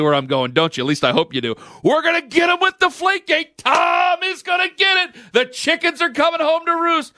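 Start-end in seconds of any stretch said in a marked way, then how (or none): 1.8-7.1 mock hater shouting in glee; mock gloating shout. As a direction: crow it like a smug hater.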